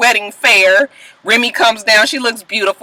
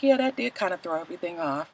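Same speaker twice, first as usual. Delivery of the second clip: much softer, meek echo of her; quiet mimic; 4 semitones lower, 15 dB quieter, faster than usual. Mimicking Amina agreeing with Peter, meek and soft. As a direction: mumble it back meekly. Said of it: "she was like".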